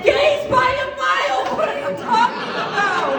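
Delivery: high, loud correction; incredulous shout. Acted. Quizmaster corrects the crowd's wrong answer, shouting incredulously. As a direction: shout them down in mock disbelief.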